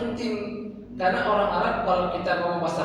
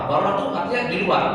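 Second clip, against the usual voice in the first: usual speaking voice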